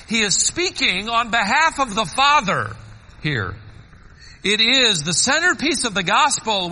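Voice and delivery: rising sermon delivery; fervent preaching. Acted. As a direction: preach it out to the congregation. Preaching John 3:16 with fervor.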